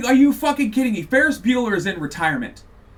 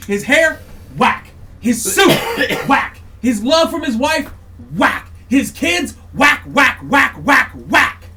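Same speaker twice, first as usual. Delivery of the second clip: higher, louder, each whack hit hard; louder and louder whacks in a rant; ranting shouts; 4 semitones higher, 6 dB louder, slower than usual. Real, not acted. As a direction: hammer every whack like a verdict. Escalating rant listing failures.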